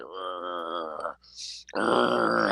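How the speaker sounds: low rumbling growl sound; imitated growl. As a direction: growl like a girl turned demon. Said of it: "making, like, growling noises".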